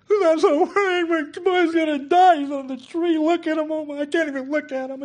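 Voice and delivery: high, loud, frantic fretting; hammed-up fearful wail; mock panicked mother. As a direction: ham up a terrified mother. Hagar voiced, fearful dramatics.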